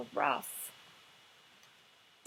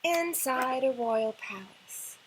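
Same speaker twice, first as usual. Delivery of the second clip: usual speaking voice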